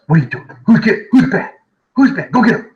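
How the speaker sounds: high, coaxing voice to the dog; pet talk. Real, not acted. Owner urging his dog on mid-lesson, high and coaxing. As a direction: egg the dog on, well up in the voice.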